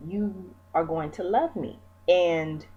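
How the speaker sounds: ordinary talk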